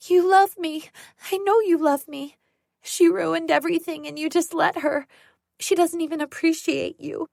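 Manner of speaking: high, loud frantic pleading; desperate pleading. Acted. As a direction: beg her to admit she loves you. Sarah desperate toward Mom.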